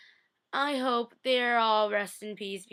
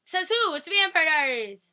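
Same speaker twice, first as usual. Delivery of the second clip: indignant retort; 6 semitones higher, 5 dB louder than usual. Real, not acted. Arguing whether a dead character returns.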